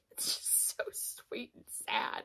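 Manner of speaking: soft, slow whisper, almost crying; a slow, tearful whisper; teary whisper. Real, not acted. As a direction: breathe it out, moved to tears. Choked up over the story.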